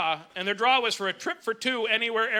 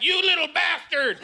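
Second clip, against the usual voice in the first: mock parental yell; 7 semitones higher, slower than usual. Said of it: "We had".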